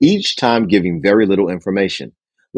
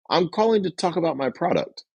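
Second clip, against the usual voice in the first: high, soft, sheepish pitch; weak salesperson; 8 semitones higher, 6 dB quieter than usual. Shown as a demonstration. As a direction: mumble it apologetically. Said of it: "Here's a weak salesperson".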